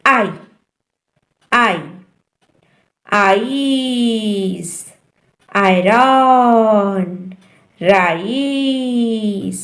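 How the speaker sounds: "आई" as "eye", then drawn out in "आइस"; long I sound. Shown as a demonstration. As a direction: stretch "आयरन" very slowly. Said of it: "आई का long sound है".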